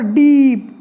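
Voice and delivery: डीप with a drawn-out, emphatic 'ee'; stress on long ई. Shown as a demonstration. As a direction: lean on the vowel of डीप. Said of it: "जोर नहीं पड़ रहा है ई पर". (डीप की ई पर खिंचा हुआ ज़ोर)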